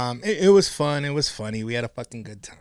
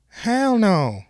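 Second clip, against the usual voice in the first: mock sassy baby mom, a bit higher; doing her voice, trying hard; a light impression of the angry mom; imitating her; 5 semitones higher than usual. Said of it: "I'm trying my best to sound like her".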